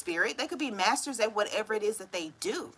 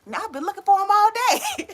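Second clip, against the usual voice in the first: higher, louder mock exasperation; exasperated search; 11 semitones higher, 9 dB louder than usual. Person with glasses on head, hammed-up exasperation. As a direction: ham up an annoyed all-day search.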